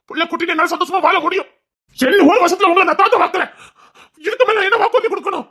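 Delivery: excited promise. Acted. Making excited promises to his uncle.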